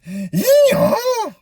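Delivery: comic donkey hee-haw; donkey bray. Acted. Donkey stops again.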